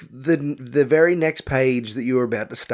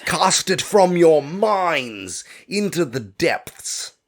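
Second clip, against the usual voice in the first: drawn-out, higher, theatrical proclamation; slow, hammed-up incantation; mock-grandiose; 5 semitones higher, much slower than usual. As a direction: intone it like a hammy sorcerer banishing a thought. Mock-dramatic dismissal of the previous topic.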